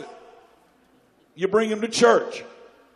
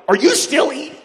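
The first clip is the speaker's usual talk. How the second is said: mock incredulous; 6 semitones higher, 8 dB louder than usual. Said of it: "it's like".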